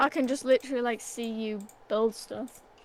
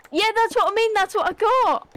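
excited; 9 semitones higher, 7 dB louder than usual. Real, not acted. Excited about the hammer.

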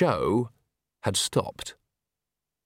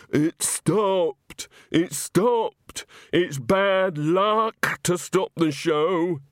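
hoarse, higher, very slow panicked cry; hoarse and frantic; 5 semitones higher, much slower than usual. A narrator's reading. Walter babbling to Granny about the stopped show, hoarse. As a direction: rasp it out like a man coming apart. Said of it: "he said hoarsely".

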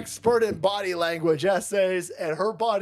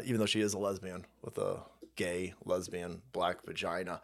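muttered aside; 14 semitones lower, 10 dB quieter than usual. Real, not acted.

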